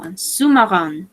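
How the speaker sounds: normal talking voice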